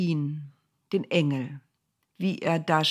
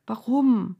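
whimpering; 6 semitones higher than usual. A narrator's reading. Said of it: "wimmerte er".

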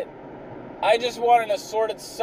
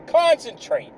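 Concentrate pushed higher, insisting; higher insistent repeat; 8 semitones higher than usual. Shown as a demonstration.

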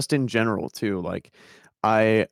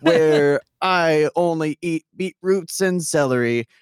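high, loud vlogger hype; over-the-top YouTuber greeting; hyper YouTuber voice; 8 semitones higher, 3 dB louder than usual. Retold or imitated. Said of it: "a person who's like".

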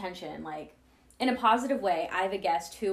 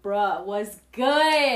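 chill bro; 9 dB louder, much slower than usual. Retold or imitated. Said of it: "They're like".

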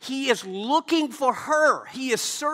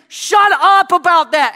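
a loud, slow, drawn-out order to shut up; loud mock-angry demand; angry command; 7 semitones higher, 12 dB louder than usual. The world silencing believers.

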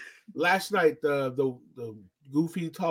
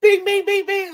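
chime noise; 7 dB louder than usual. Acted.

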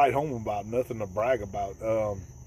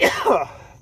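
loud cough on a gnat; choking cough; 12 semitones higher, 7 dB louder than usual. Real, not acted. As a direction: cough up the bug. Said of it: "choking on them gnats".

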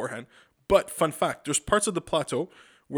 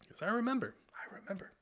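whispered, drop to a breath; quiet whispered recollection; hushed whisper; 7 semitones higher, 9 dB quieter, faster than usual. Real, not acted. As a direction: whisper it like a secret. Dramatic hushed aside.

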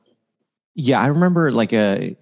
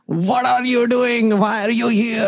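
high, slow put-on Indian accent; 14 semitones higher, slower than usual. Comedian showing the accent he does on stage, Indian accent. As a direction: ham up an Indian accent as a quick demo. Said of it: "what kind of Indian accent I'm doing".

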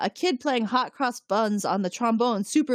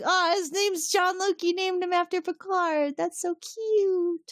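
a much higher, slowing gush of delight; 12 semitones higher, slower than usual. Retold or imitated. Fan gushing that the son is named after Picard, high and cooing. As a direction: coo over it like it's adorable. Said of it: "And it's like".

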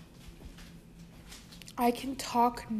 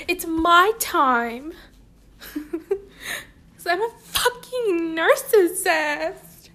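mock diva; 6 semitones higher, 4 dB louder, slower than usual. Joking self-importance.

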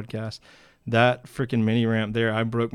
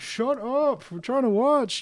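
much higher mock shushing voice; annoyed crowd; 14 semitones higher, slower than usual. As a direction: mimic spectators brushing off the injured kid. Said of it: "And everybody's like".

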